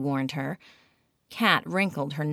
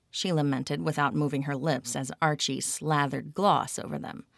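usual speaking voice

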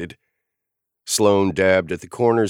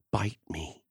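nearly silent, much softer; mouthed; 11 dB quieter than usual. A narrator's reading. Sloane mouthing an insult at Lucian, near silent. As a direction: mouth it without voice. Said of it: "she mouthed at me".

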